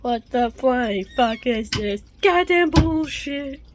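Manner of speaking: loud and slow, each word spat; slow furious outburst. Real, not acted. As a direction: drag out the fury. Furious at the mess.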